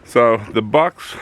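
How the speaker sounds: normal talking voice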